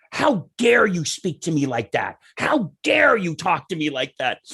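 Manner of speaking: drawn-out, imitated outrage; mock indignation. Woman scolds the son who swore at her, indignant. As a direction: scold in offended disbelief, leaning on DARE.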